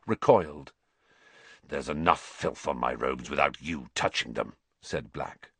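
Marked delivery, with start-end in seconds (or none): 1.6-4.6 low, contemptuous voice; cold disgust. Acted. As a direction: sneer it low.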